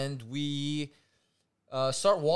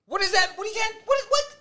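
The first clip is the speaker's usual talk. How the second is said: much higher, loud baffled voice; mock disbelief; 7 dB louder than usual. Reacting to the no-dancing rule, high baffled sputter. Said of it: "Legit, I was like".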